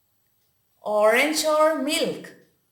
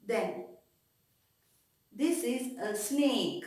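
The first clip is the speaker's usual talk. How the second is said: usual speaking voice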